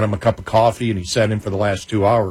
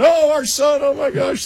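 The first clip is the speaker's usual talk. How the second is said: much higher, gasping with parental pride; gushing proud parents; slower than usual. Retold or imitated. Parents hearing their son on the radio, gushing high. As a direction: swoon over your son like a thrilled mother. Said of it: "And they went".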